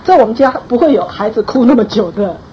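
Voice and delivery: ordinary talk, nothing marked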